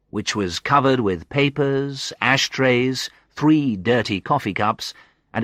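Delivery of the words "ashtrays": plain reading voice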